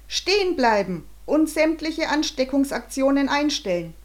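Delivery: loud, high official command; booming megaphone voice. A narrator's reading. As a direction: boom it out like a megaphone order. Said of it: "dröhnte eine Megafonstimme".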